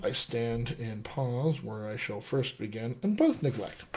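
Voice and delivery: low, very soft reading to oneself; murmured read-through. Real, not acted. Coach crossing out words in the script, murmuring it through. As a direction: murmur the verse under your breath, low and private.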